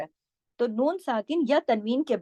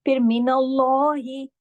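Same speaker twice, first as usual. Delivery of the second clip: slow, louder chanted मिनल्लाहि with a full lam; recitation; 2 semitones higher, 4 dB louder, slower than usual. Shown as a demonstration. Teacher reciting the next word for students, louder chant. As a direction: intone it as in prayer. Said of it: "जलाला हो रहा है".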